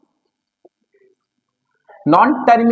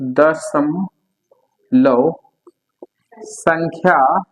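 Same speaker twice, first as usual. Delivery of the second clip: slow, lower voice, word by word; slow dictation; 5 semitones lower, much slower than usual. Shown as a demonstration. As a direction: dictate so the class can write. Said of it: "teacher dictating definition". (धीमे स्वर में लिखवाना)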